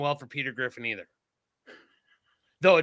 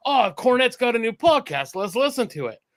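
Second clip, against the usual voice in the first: higher, louder pepped-up quote; mock eager; 6 semitones higher, 5 dB louder than usual. Telling how he gets home to a new podcast, mock eager. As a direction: act out getting keen on a new episode.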